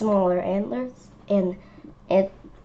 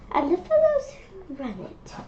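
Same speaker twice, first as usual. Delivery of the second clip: put-on lightly raised boyish voice, a bit higher; Gerald's voice; 10 semitones higher than usual. Child reading Gerald's boast, a hint higher voice. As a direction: voice young Gerald a touch higher.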